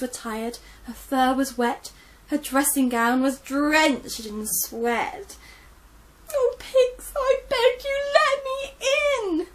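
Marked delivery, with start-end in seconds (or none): 6.3-9.5 high, loud, crying plea at the door; tearful begging. Acted. Old Gran begging the pigs to let her in, crying. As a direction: beg through sobs, voice way up.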